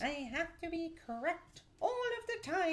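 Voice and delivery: high-pitched mocking whine; mocking know-it-all. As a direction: mimic a partner who must be right. Mocking her partner's know-it-all manner.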